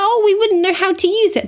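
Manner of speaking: high, lightly voiced old folks; a hint of fussy grandparents; grandparents refusing. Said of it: "they're like".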